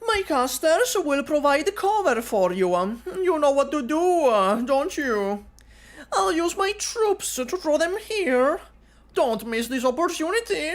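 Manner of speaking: high, sly schemer's voice; high, teasing villain coaxing an ally; scheming W. Acted. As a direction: coax him like a sly mercenary.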